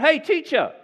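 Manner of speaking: loud, high call from the crowd; cries out. Retold or imitated. Rando in the crowd calling out to Jesus, loud and high. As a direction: shout it over the crowd to get his attention. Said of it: "just cries out".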